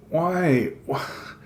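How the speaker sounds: high, broken wail; between sobs. A narrator's reading. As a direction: catch on the first word, then plead. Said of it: "It comes out between sobs".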